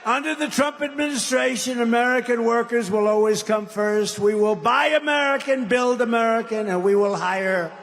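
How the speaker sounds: emphatic podium declaration; formal rally speech. Real, not acted. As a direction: declaim it to the crowd like a prepared pledge. Reads out a formal pledge.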